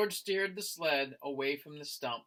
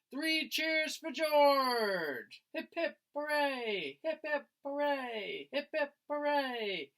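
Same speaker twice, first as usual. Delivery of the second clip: high, slow hip hip hooray; happy cheer, higher throughout; 10 semitones higher, slower than usual. Acted. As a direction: lead the crowd cheering for George. Crowd cheers for George.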